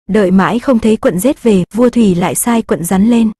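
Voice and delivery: ordinary delivery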